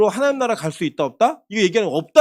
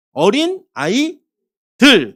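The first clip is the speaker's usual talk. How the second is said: "아이들" drawn out, 들 pushed louder; stress on 들; 2 semitones higher, 4 dB louder, much slower than usual. Shown as a demonstration. Pastor showing the congregation a plural, stress on 들. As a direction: say "어린 아이들" very slowly, leaning on 들.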